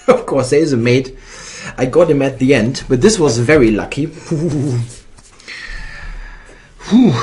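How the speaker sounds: loud, fast relieved laugh; excited relief. Real, not acted. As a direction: burst out with lucky relief. Relieved after finding mate.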